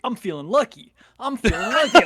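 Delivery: high, loud, laughing taunt; cocky taunt voice. Retold or imitated. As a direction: gloat it, laughing. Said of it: "and he's like".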